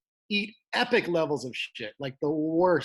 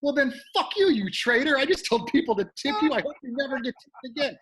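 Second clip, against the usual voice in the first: higher, louder furious retort; snapping back; 10 semitones higher, 3 dB louder than usual. Retold or imitated. Comic snapping at the bartender onstage, high and angry. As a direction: lash out at the traitor. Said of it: "I snapped".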